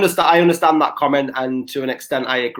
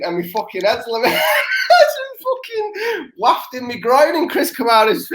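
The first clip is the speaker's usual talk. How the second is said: higher, words breaking up into laughter; laughing while talking; 10 semitones higher than usual. Real, not acted. Telling a mate about landing on Chris Kamara, laughing helplessly. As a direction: struggle to get the story out through laughter. Cracks up telling own story.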